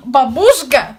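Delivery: sharp loud call of the name. Acted. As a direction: call her out loudly. Scolding sister for laughing.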